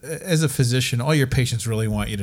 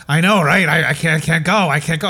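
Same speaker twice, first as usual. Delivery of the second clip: put-on old patient's voice; 4 semitones higher, 6 dB louder than usual. Elderly patient voiced in story.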